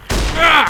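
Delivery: short, loud, high-pitched yell; sudden combat cry; battle yell. Pretend. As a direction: yell out as you open fire. Firing at attacking geckos in game.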